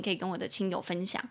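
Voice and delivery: usual speaking voice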